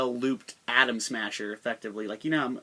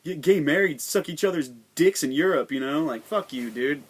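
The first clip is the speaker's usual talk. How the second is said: mock angry patriot; 2 semitones higher than usual. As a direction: ham up an outraged flag-waver ranting. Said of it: "They'll be like".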